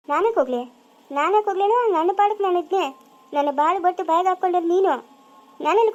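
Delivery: high-pitched, aggrieved outburst; indignant protest. Acted. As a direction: protest hotly and lay the blame. Acted quarrel, blaming the other.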